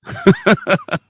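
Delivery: short chuckle; laugh. Real, not acted. Preacher ranting to the congregation pauses, short chuckle. Speaker's own laugh amid rant.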